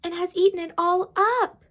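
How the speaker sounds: high, small bear voice; high-pitched little cub; wee little Baby Bear voice. A narrator's reading. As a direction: complain like a tiny storybook bear. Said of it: "in his wee little voice".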